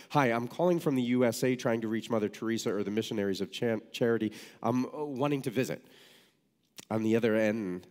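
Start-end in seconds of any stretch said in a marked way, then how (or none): 0.1-5.8 hurried phone spiel against a ticking bill; railing. Retold or imitated. Student calling Calcutta at four dollars a minute, railing. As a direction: rattle it off fast. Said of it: "I started railing".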